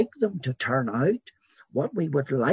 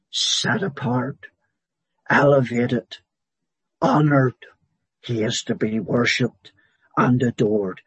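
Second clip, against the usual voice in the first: louder, rising preaching on God's power; full-force preaching; 5 dB louder than usual. Acted. Preacher exalting God's sovereignty to the congregation, louder and rising. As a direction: proclaim each title like a herald.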